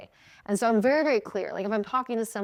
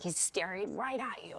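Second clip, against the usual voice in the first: hushed, secretive report; conspiratorial whisper; 11 dB quieter than usual. Retold or imitated. Teammate reporting that he is looking, conspiratorial whisper. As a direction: whisper it to a friend.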